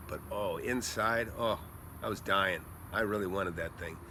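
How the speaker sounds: low, pained confession; retold anguish. Investor admitting he badly wanted the property, pained. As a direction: sink low, as if quietly hurting inside. Recalls hiding his deep disappointment.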